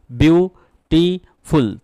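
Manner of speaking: Beautiful said very slowly in three separate syllables, with a pause after each; teacher sounding out beautiful syllable by syllable; broken into syllables. Shown as a demonstration. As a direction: break beautiful into three drawn-out beats. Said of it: "এখানে তিনটে syllable রয়েছে".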